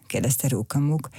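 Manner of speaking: plain reading voice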